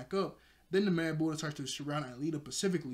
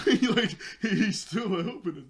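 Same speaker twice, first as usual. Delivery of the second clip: laughing; 6 semitones higher, 9 dB louder, slower than usual. Real, not acted. Laughing over the plot twist.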